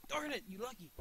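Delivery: high-pitched annoyed cry; high, exasperated complaint; frustrated outburst. Real, not acted. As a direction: blurt it out at a lucky opponent. Frustrated at opponent's luck.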